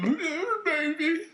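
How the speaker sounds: high, mocking come-on; mock flirty woman. Comic mocking the woman's greeting, high and hammed-up. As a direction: lay on the fake seduction, high. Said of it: "I don't fuck with bitches".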